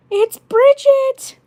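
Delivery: put-on very high, loud, drawn-out farm girl squeal; slow, squealed farm girl accent, very high; Bridget farm girl accent. Shown as a demonstration. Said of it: "the Bridget accent".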